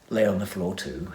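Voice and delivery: ordinary delivery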